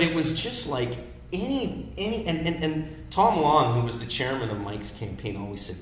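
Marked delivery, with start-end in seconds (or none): none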